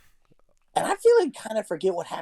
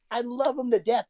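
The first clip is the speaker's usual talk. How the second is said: ordinary delivery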